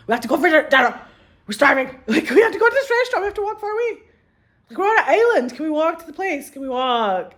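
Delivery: put-on high, loud, laughing whine of a starving child; whiny hungry kid. The kids begging making-out parents to go for dinner, a high laughing whine. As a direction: beg like a bratty kid who needs dinner now.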